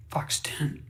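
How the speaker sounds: whispered, barely voiced; hushed whisper. A narrator's reading. Fox narrator naming dead friends, whispering. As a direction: breathe the name out in grief.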